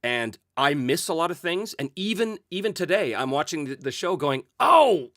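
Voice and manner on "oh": loud, high "oh!"; dismayed realisation. Retold or imitated. Host watching the show and spotting his slip, loud dismay. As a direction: cry out as if catching your own mistake. Acts out realising a missed thing.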